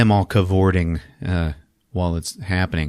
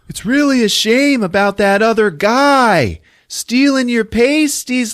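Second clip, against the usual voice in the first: high, louder, slow campy chatter; campy high voices; 5 dB louder than usual. Acted. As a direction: play it prissy and over the top. Heroes chat while drifting down.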